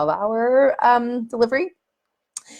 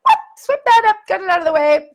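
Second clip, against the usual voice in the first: high, bright, brisk midwife voice; breezy, unbothered cleanup call; chirpy no-fuss midwife; brisk cheery midwife; 10 semitones higher, 6 dB louder, faster than usual. Retold or imitated. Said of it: "my midwife, like".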